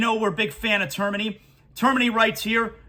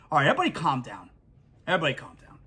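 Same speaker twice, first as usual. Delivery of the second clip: much lower, quick settling-down voice; calming himself; 9 semitones lower, 4 dB quieter, much faster than usual. Real, not acted. Host cooling off after his own rant, lower and calmer. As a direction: talk yourself down from a rant. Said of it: "Getting all worked up here".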